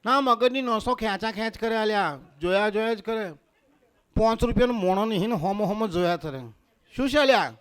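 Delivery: mock Ahmedabad dialect telling-off; Amdavadi woman. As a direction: scold him like an Amdavadi woman. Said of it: "અમદાવાદની બાયુને જોઉં તો તરત જ એક જ ડાયલોગ".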